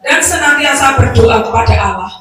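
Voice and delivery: loud, projected Bible reading; scripture reading. Acted. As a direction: read the passage out to the congregation, loudly. Reads the Bible passage aloud. (pembacaan Alkitab dengan suara lantang)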